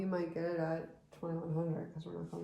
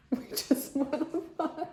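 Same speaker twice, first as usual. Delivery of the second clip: laughing; 9 semitones higher, 3 dB louder than usual. Real, not acted. Just won the auction.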